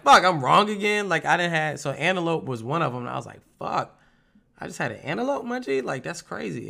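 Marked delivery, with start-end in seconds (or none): 0.0-1.1 a high, loud groan at being wrong; high and loud, mock defeat; exasperated curse. Guessing wrong at blind dining. 3.6-6.7 amazed disbelief. Retold or imitated. Learning he ate antelope.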